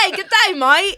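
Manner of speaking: put-on Australian accent. Mocking Australians.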